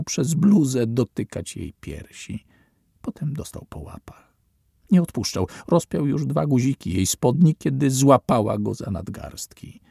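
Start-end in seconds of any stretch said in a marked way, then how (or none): none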